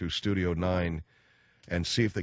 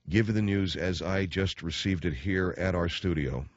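ordinary delivery

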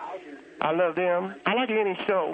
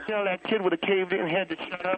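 usual speaking voice

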